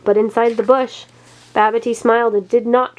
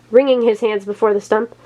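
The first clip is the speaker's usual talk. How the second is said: usual speaking voice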